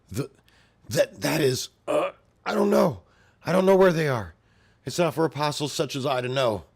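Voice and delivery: high, loud panicked monster denial; terrified creature. Acted. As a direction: stammer it out in terror. Terrified apostle answers Guts.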